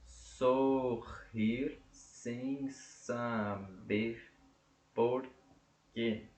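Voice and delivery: muito lento, sílaba por sílaba; escansão silábica. Shown as a demonstration. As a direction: separe cada sílaba devagar. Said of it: "Um, dois, três". (escandindo o verso devagar)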